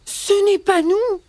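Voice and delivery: high-pitched bunny protest; crying rabbits. Acted. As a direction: plead innocence in a high rabbit voice. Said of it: "crient les lapins".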